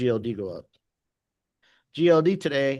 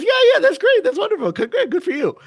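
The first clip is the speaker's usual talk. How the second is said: high, louder gushing imitation of a wife; mock enthusiasm; 6 dB louder, faster than usual. Wife answering husband's twenty-five grand news, mock enthusiasm. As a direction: pitch way up in hammed-up congratulation. Wife acted in retold exchange.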